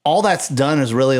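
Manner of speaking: ordinary talk, nothing marked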